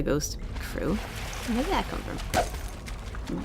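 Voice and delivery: ordinary talk, nothing marked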